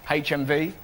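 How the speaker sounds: ordinary talk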